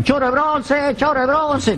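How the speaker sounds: high taunting yell from the street; a hammed-up crowd taunt, high-pitched; mock jeering shout. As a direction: jeer at him, pitched high. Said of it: "todos le gritaban".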